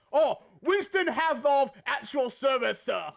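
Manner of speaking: hammed-up English adventurer at your service; Mummy explorer impression. Imitating the Mummy pilot for chat, plummy British accent. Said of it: "that character in The Mummy".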